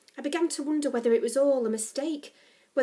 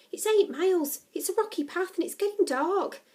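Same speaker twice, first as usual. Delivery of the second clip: higher-pitched objection; protesting; 8 semitones higher than usual. A narrator's reading. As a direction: argue against a mad idea. Becky objects to a night walk.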